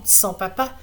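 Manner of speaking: ordinary delivery, nothing marked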